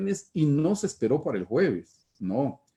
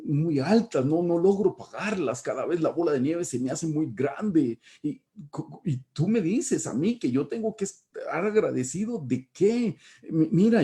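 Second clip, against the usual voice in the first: indignant outburst; 4 semitones higher than usual. Retold or imitated. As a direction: pour out every grievance in a rush. Reenacting his protest to counselor.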